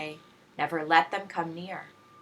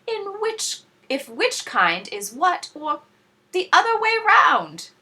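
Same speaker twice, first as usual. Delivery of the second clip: much higher, loud fretting; frowning worry; 9 semitones higher, 7 dB louder than usual. A narrator's reading. Star Bellies fretting they can't tell apart, high and loud. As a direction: panic over who is who. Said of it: "they all frowned".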